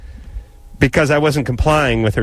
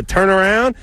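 higher, rising questioning guess; tentative guess; 7 semitones higher than usual. Retold or imitated. Traveler guessing what the guard wants, tentative guess. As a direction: act out finally working out the order.

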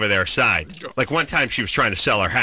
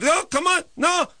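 high, urging mock Israeli accent; horrible Israeli accent. Neighbor urging him to follow, mock Israeli accent. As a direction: falsetto Israeli-accent caricature, insisting. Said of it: "It's a horrible accent".

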